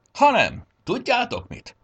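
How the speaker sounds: high, loud bright idea; gladly spring a surprise on the group; merry, lifted announcement; cheerful. A narrator's reading. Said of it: "fordult vidáman a nyúlsereghez".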